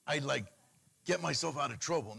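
ordinary talk, nothing marked

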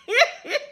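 short high laugh; high squeal of laughter. Real, not acted. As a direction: let a giggle escape. Ty's nine toes joke.

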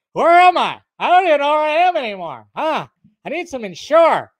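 put-on high, loud, mumbling old president voice; Biden impression. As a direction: play a confused, rambling old politician. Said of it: "first time I tried to do a Biden impression".

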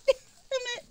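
high, soft laugh-whine on damn; laughing curse. Real, not acted. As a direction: curse through a helpless laugh. Dropped chips, laughing curse.

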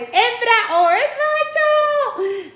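word jumps up high in pitch; squeaky high. Acted. Asking viewers if the pet is female or male, squeaky high. Teasing question about the pet's sex.

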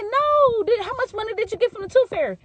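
put-on high-pitched kid, excited; a child pestering with questions; a little boy asking about the tooth fairy, eager and high.

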